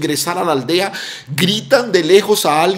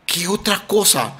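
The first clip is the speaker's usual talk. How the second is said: normal talking voice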